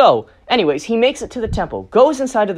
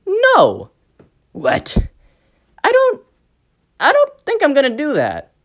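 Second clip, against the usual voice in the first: higher, nervous little-creature voice; squeaky minion refusal; 9 semitones higher than usual. Acted. WSB refusing Noir Rabbit, in a higher nervous voice. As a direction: refuse timidly but firmly.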